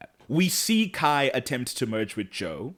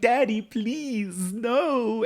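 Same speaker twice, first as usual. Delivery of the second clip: high, drawn-out mock pleading; pleading children; 12 semitones higher, much slower than usual. Twins begging their dad to stop, high and pleading. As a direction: beg in a high voice, stretching each word. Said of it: "They're pleading. They're like".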